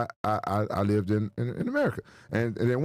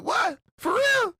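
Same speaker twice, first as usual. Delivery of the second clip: shocked; much slower than usual. Retold or imitated. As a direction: react in disbelief, voice jumping up. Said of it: "I was like".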